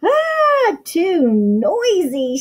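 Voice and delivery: high startled "Ah" then a slow drawn-out yell; mock yell for the kids, squealing the Ah. Goldilocks in the clanging chair, a hammed-up yell. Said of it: "she yelled".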